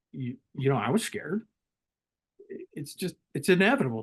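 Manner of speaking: normal talking voice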